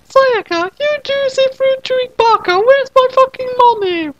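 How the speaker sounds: put-on a tiny high girl shaking down a debtor; high squeaky girl demanding her money; demanding Madoka voice. Madoka character voice.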